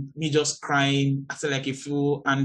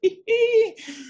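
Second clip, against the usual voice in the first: a sudden high squealing laugh; high burst of laughter; 3 dB louder than usual. Real, not acted. Preacher laughing at her own joke, high squeal. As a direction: let out a high giggle.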